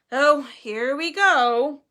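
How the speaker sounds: loud, very slow, resentful; bitter. A narrator's reading. Nick dreading the headless riders, bitter. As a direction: drag it out in weary annoyance. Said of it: "said Nearly Headless Nick bitterly".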